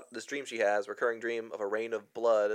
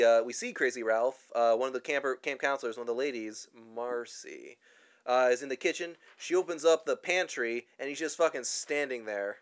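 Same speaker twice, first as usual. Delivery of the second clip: usual speaking voice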